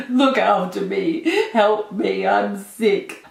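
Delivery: mock-pitiful whine with a gasp first; big sook. Retelling her sick plea to boyfriend, hammed-up sook. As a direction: imitate yourself being a drawn-out, needy sook. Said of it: "I was being a big sook".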